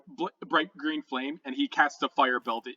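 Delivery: usual speaking voice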